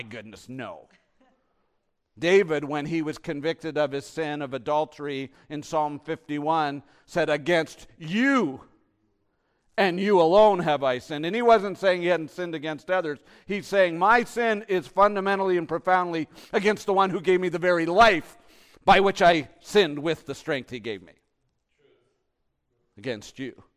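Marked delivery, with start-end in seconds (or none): 2.2-7.3 loud, driving sermon delivery; full-force preaching. Real, not acted. 7.3-8.7 loud, raised, drawn out on "you"; impassioned cry. Acted. Psalm cried out to God. 9.8-21.1 very loud, high, insistent sermon; full-force preaching. Real, not acted.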